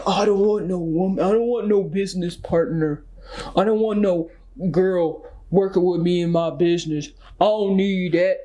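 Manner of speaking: lightly voiced dismissive guy; a hint of a proud man waving off a working woman; mocking stubborn man. Mimicking men who talk like this, with a touch of scorn.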